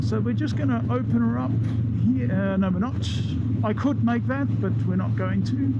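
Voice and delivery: talking inside a motorcycle helmet; muffled helmet mic over engine noise. Real, not acted. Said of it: "in-helmet sound check".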